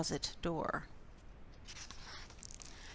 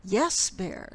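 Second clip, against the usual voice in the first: usual speaking voice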